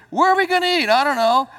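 high, loud put-on whine; whiny after-church voice. Preacher mimics a family member after church, high whiny voice. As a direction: play the indecisive one asking where to eat.

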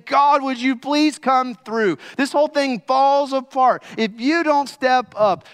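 loud, high cry for help; desperate pleading. Acted. Disciples crying out to God in the storm, desperate pleading. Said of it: "They're pleading with him, crying out to him".

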